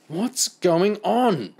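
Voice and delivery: high, loud, drawn-out question; stretched, exasperated call; irritated demand. A narrator's reading. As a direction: demand it slowly and loudly, just woken. Woken woman demands an explanation.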